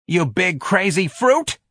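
put-on boy snarling as he slouches away. A narrator's reading. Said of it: "George snarled more loudly".

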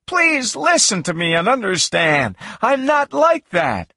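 high-pitched, urgent plea; crying out. A narrator's reading. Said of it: "I cried".

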